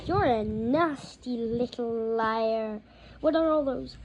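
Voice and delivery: slow loud contempt; contemptuous. A narrator's reading. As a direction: sneer it slowly. Petunia accuses Harry.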